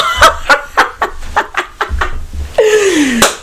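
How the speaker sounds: high, breathless burst of laughter. Real, not acted. Reviewer cracking up after yelling, high laugh.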